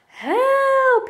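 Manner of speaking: long, high-pitched cry for help; storybook scream. A narrator's reading. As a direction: scream it like a boy fleeing a nightmare. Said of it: "he screamed".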